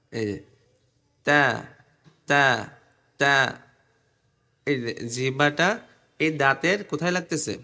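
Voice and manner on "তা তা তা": isolated, carefully placed "তা"; model "তা" pronunciation. Shown as a demonstration. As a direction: separate "তা", tongue at the root of the teeth. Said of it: "দেখেন আমার জিহ্বা".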